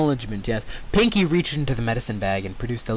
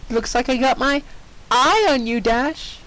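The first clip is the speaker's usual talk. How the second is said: much higher playful quip; high teasing Pinkie; 11 semitones higher than usual. Acted. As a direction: tease her with the pun. Pinkie's eye pun.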